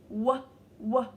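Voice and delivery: "w" as two separate "wuh" sounds; letter sound demo. Shown as a demonstration. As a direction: punch out "wuh, wuh" before the word. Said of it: "w sound of W".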